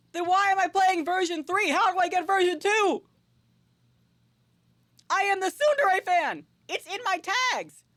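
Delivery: high-pitched, hammed-up fan outrage; indignant outburst. Real, not acted. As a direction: protest at full pitch, as if personally wronged. Mock outrage at missing tsundere version.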